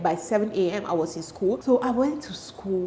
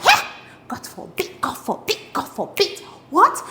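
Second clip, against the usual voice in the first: hammed-up shock, jumping high; shocked refusal; 10 semitones higher than usual. Girl reacting to boy asking her out, shocked refusal. Shocked reply to love confession.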